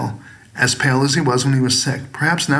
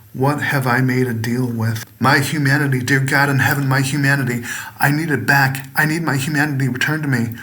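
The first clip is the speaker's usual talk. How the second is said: usual speaking voice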